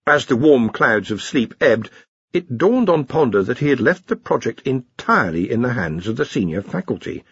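ordinary delivery, nothing marked

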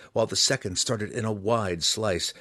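usual speaking voice

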